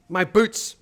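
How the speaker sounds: loud, high startled gasp; gasping alarm. Acted. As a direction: catch your breath in alarm. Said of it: "he gasped".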